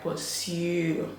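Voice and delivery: pursue said very slowly with rolled tongue; very slow, careful Ghanaian pursue. Shown as a demonstration. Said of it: "there's a way they roll the tongue".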